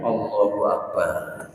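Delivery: exasperated sigh. Real, not acted. Preacher groaning at a burnt-tofu post, soft and slow. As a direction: let it sink out in disbelief.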